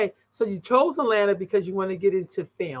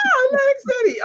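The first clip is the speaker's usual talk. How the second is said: high, loud burst of surprise; surprised exclamation; 6 dB louder, faster than usual. Real, not acted. Host hears the guest's real birthplace, surprised and loud. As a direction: jump up in pitch, caught off guard.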